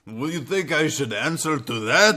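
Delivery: high, loud mock Russian indignation; Russian accent. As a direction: protest in a hammed-up Russian accent. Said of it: "or Russia".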